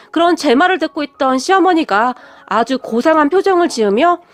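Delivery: ordinary delivery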